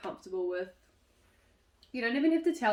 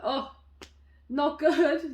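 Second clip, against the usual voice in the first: high, loud mock groan of admission; hammed-up, raised-pitch moan; candid complaint; 7 semitones higher, 8 dB louder, much slower than usual. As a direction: let it out to close friends, half-laughing. Acts admitting it to friends.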